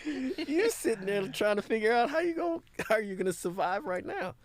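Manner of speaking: put-on amused high scolding of old worries; mocking past self. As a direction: tease your past self, laughing high. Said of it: "And I go".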